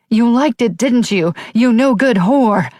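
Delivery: high, loud, slow furious scream; screaming mother. A narrator's reading. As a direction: spit it out as a raging mother. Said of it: "Her mother screaming".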